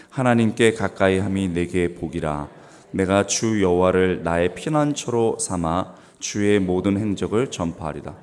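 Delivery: loud, measured, level recitation; scripture read-aloud. Real, not acted. Preacher reading with the congregation, loud unison-style recitation. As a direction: lead the room through the verse in a strong voice.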